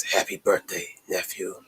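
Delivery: acted hushed greeting, dropping away; understated menacing greeting. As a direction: quietly deliver a pointed birthday wish. Acted villain's quiet greeting to uncle.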